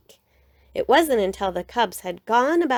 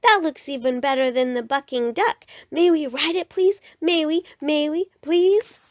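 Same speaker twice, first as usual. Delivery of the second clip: begging cubs; 7 semitones higher, 3 dB louder than usual. A narrator's reading.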